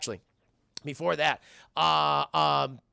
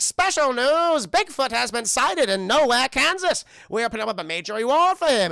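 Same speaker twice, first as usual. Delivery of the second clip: cartoon news announcer; 6 semitones higher, 7 dB louder than usual. Show narrator breaking Bigfoot news, booming announcer. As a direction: announce it like a hammed-up special bulletin. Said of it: "it's the narrator of the show".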